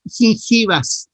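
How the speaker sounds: gingivas said slowly and clearly, stress on gin; teacher modelling the right word; correct term. Shown as a demonstration. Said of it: "no son encías, sino son".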